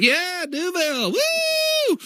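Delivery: high, slow build into a whooping shout; yelling with joy at the camera; wild cheering. Retold or imitated. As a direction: cheer like you're dancing alone at home. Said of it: "I'm like".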